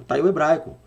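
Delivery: normal talking voice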